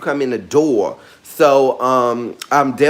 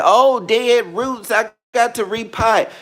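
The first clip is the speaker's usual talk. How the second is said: mock worried plant owner; 9 semitones higher than usual. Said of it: "some of you will be like".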